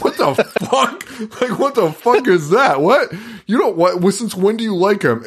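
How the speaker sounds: shocked viewer voice, laughing; baffled. Retold or imitated. Viewer baffled by Herman's rudeness, high and laughing.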